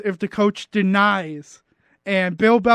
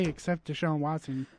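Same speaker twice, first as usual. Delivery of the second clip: quiet; 4 semitones lower, 11 dB quieter than usual. Shown as a demonstration. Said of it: "You could just say".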